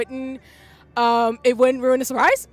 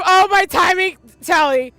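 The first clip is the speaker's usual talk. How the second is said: ordinary talk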